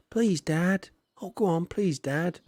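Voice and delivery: put-on high, wheedling kid begging dad; mock child pleading with dad. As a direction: beg like a whiny child.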